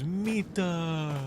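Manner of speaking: high Finnish "Mita"; imitating enemy AI. Streamer voices the enemy's reply in a high voice. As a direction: answer lightly in the enemy's voice. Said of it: "And he goes".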